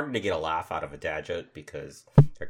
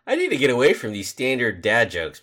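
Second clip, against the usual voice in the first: ordinary delivery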